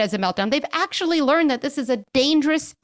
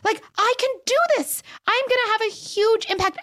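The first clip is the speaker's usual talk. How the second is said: much higher, eager pep talk; excited; 11 semitones higher than usual. Shown as a demonstration. Parent cheering herself on during a tantrum, bright and high. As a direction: pump yourself up. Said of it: "Get excited".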